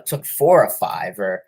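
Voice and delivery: ordinary delivery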